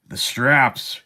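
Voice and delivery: ordinary delivery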